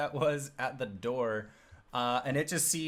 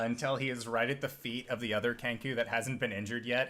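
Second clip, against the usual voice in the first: usual speaking voice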